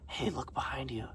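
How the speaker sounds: hushed whisper to a friend. Retold or imitated. Lady whispers to her friend about the mime behind her, hushed. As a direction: whisper it so the mime won't hear. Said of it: "tells her friend, like".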